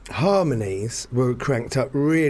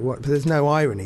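normal talking voice

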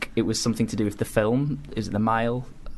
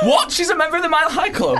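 high, laughing disbelief; shocked; 3 dB louder than usual. Acted. Mock shocked reaction.

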